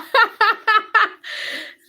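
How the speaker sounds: high, loud burst of laughter; delighted laugh. Real, not acted. Delighted at a viewer's comment.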